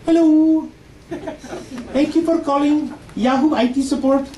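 put-on high tech-support Indian accent; call-centre agent with an Indian accent. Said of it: "I'm sure you've heard this voice before".

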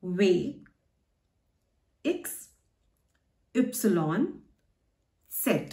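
"V, X, Y, Z" as veh, iks, üpsilon, tset; each letter name alone, pauses between; German letter names. Shown as a demonstration. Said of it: "pronunciation of the German letters".

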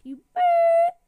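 high loud electronic squeal; robot beep. Acted. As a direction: beep like a machine.

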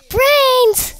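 high, loud one-word yell; excited shout. A narrator's reading. Giga football player spotting food, shouting. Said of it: "shouted the football player".

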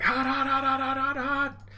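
high, rapid-fire panicky yelps; mock scalded yelping. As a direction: yelp as if burned, cartoon style. Characters scalded by stew.

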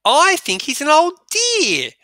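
put-on Susan, fond of the old professor; voicing Susan. Teacher reading Susan's line, high and fond. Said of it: "said Susan".

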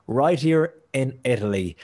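usual speaking voice

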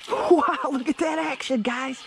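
high laughing shout; thrilled yelp at a fish; excited. Real, not acted. Bass spotted on lure.

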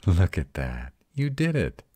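loud, leaping high on "did"; proud, excited encouragement; delighted praise. Acted. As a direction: cheer on a partner's first success. Praising partner's first successful levitation.

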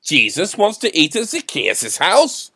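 loud, high-pitched disbelief; surprised. A narrator's reading. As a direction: exclaim it as an astonished question. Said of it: "said someone in surprise".

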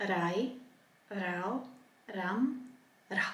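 soft front R in each of "Ra, ra, ri, ri"; softly spoken "rai, rau, ram", light tapped R; soft R drill. Shown as a demonstration. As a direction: drill "ra, ri, ru" with the tongue lightly behind the teeth. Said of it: "made in the front of the mouth".

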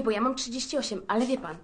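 usual speaking voice

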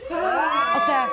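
squeaky high shocked gasp; shocked shriek. Acted. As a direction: jump way up in pitch in disbelief. Shocked by the confession.

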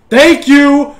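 high, loud shout; loud exclamation. Real, not acted. Gamer reacting to the play, loud high exclamation. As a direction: call it out sharply at the screen.

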